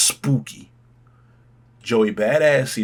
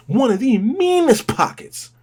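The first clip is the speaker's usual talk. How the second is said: much higher, slow, hyped repeat; excited repeat; 13 semitones higher, 4 dB louder than usual. Real, not acted. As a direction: shout the praise up high.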